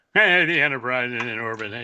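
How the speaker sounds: old cartoon character voice; Mister Magoo. Host joking before his recap, Mister Magoo impression. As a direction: put on a comic cartoon-character voice. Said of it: "do it as Mister Magoo".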